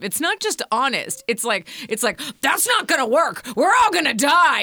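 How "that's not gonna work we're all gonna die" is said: higher, louder blunt alarm; mock aggressive researcher predicting doom. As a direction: hammer it like a scary expert. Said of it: "her tone is, like, aggressive".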